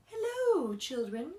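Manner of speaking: a lightly sweet, high hello. A narrator's reading. Teacher greeting her class, in a lightly sweet voice. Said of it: "someone said in a sweet voice".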